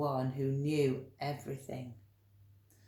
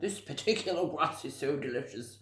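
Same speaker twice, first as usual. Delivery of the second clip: greedy zebra voice; 3 semitones lower, faster than usual. A narrator's reading. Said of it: "he went".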